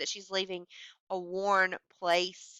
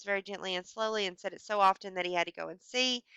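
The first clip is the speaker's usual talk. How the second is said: dramatic story reading; 2 semitones higher, 7 dB louder than usual. Acted. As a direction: read it like the story's creeping climax. Reads the story's climax aloud.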